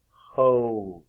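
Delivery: "Hose" with a drawn-out long O; long O demonstration. Shown as a demonstration. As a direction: stretch the O in "Hose". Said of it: "a long O sound".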